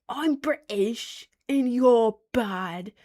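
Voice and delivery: exaggerated, slow British accent; mock British accent. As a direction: put on a drawn-out British voice.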